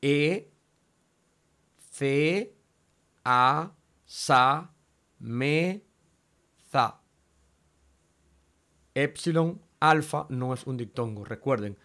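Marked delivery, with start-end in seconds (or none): 0.0-7.0 each syllable of "E, ce, a, sa, me, za" set apart by pauses. Shown as a demonstration. Teacher dividing a Greek word, "E, ce, a, sa, me, za" in separate syllables. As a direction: say "E, ce, a, sa, me, za" one piece at a time. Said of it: "esa es la manera en que se divide en sílabas".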